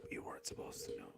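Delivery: whispered, quick conspiratorial aside; a teasing hushed aside to the audience; mock secret whisper. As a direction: lean in and breathe it like a secret. Whispered joke after news headline.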